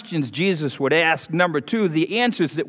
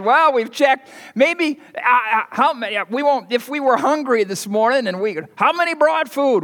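flustered Andrew; 8 semitones higher than usual. Acted. Andrew voiced as flustered disciple.

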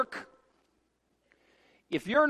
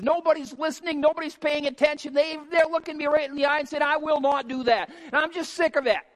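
higher-pitched, indignant mock complaint; fed-up attitude; 8 semitones higher than usual. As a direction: act out a prophet who is sick of it all. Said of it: "Jeremiah had that attitude one time, and he says".

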